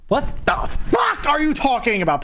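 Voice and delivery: high, startled what the fuck; shocked outburst. Retold or imitated. Told to pull pants down, shocked outburst. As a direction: blurt it out in disbelief. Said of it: "I'm like".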